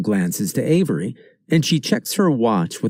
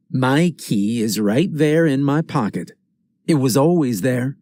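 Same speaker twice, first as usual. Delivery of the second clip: plain reading voice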